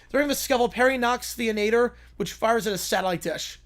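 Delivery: usual speaking voice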